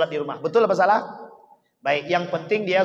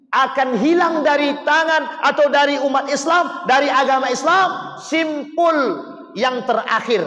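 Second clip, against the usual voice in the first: impassioned preaching; 7 semitones higher, 5 dB louder than usual. Acted. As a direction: cry the Prophet's words out to them. Preacher quotes hadith with force.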